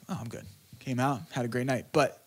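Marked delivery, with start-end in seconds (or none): 0.1-0.4 relieved. Retold or imitated. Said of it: "I was like".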